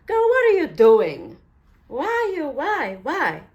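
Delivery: put-on high, loud self-scolding Girl, what are you doing; scolding herself. Crafter retelling how she scolded herself, loud and high. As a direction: snap at yourself in a loud, high voice. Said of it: "until I said".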